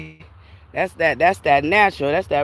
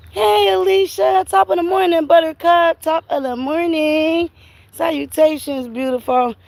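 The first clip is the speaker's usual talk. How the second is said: sing-song greeting; 13 semitones higher than usual. Real, not acted. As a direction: greet a friend in a high, stretched-out lilt. Calling out a greeting to Alicia.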